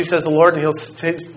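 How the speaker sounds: ordinary talk, nothing marked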